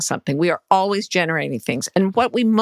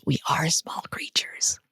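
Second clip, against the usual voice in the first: whisper; 4 semitones lower, 7 dB quieter, slower than usual. Real, not acted. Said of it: "tender aside".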